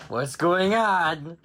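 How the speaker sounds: high, loud, slow alarmed cry; alarmed worm. Acted. Worm feeling the apple lifted, alarmed high cry. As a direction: cry out in confusion.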